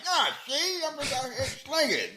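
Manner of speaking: high-pitched mock New York gangster; New York gangster accent. Mocking a movie actor's accent, high New York gangster voice. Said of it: "that New York gangster accent".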